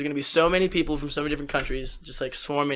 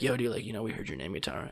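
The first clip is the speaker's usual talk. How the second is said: hushed friend; 6 semitones lower, 14 dB quieter than usual. Retold or imitated. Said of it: "my friend's telling me".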